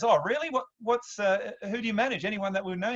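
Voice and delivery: high-pitched imitation of mum; high, inquisitive mum voice; intrigued mum. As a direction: play mum fishing for names, voice lifted high. Mum reacts to talent manager.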